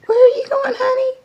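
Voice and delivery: high, loud coaxing squeal to the guinea pig; excited call. Real, not acted. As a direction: call out to her in a thrilled squeak. Cheering pet on bridge.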